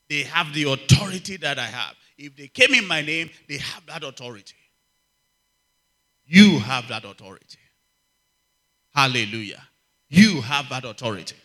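loud, ringing declarations to the congregation; full-force preaching. Acted. Preacher declaring authority over the congregation, loud and forceful. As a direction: proclaim it, hammer every line home.

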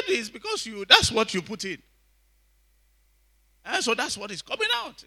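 high-pitched imitation of a retort; retold animated reply. Preacher retelling a brother's answer to a pastor, animated imitation. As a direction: explain it back, pitched well up.

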